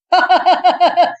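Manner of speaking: high, loud jeering laugh; mocking laugh. A narrator's reading. Rohan laughing mockingly at the two boys. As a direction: laugh at their stupidity.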